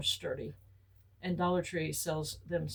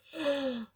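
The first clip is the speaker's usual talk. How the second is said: mock gasp; 8 semitones higher than usual. Sees yard full of weeds.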